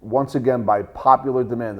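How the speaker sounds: loud, high, energetic greeting; hyped show intro. Acted. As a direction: pump up the audience. Energetic show intro, second take.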